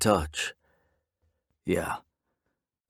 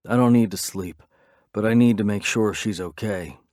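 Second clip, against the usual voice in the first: ordinary delivery, nothing marked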